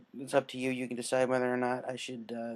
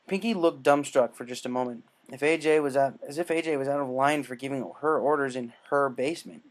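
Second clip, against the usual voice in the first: plain reading voice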